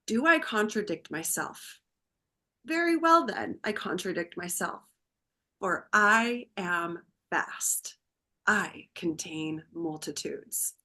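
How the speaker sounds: hushed, slow, reverent recitation; quoting Whitman softly. Retold or imitated. Chaplain reciting Walt Whitman to the grieving daughter, hushed and slow. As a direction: recite it quietly like a poem. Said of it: "a quote by Walt Whitman".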